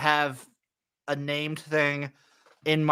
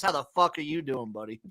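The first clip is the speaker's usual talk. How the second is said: ordinary talk, nothing marked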